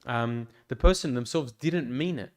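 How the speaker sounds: ordinary delivery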